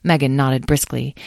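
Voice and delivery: ordinary delivery, nothing marked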